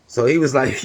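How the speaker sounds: ordinary talk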